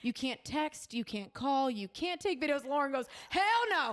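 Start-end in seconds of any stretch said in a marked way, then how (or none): 3.3-3.9 higher-pitched mock outrage; emphatic refusal. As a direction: refuse point-blank, pitched up high. Quoting friend's indignant refusal.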